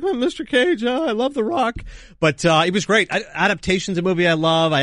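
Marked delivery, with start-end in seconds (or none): 0.0-1.8 high, gushing fanboy voice; starstruck fan. Retold or imitated. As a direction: fawn over him like a nervous fan. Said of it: "it was like".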